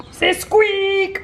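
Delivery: long, drawn-out "squeak" for the camera; slow pose-for-the-picture squeak; photo-time squeak. Acted. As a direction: stretch "squeak" like a photo call. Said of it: "Why can't be something else".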